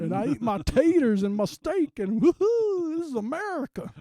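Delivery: high, loud cowboy whooping; a yee-haw patriot showing off; a mock Southern gun nut whooping at dinner; hammed-up gun nut. Mocking a patriotic gun owner.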